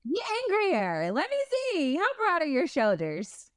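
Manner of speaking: high, swooning tease; playful high-pitched flirt; mock swoon. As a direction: egg him on like a smitten fan. Teasing, swooning at angry man.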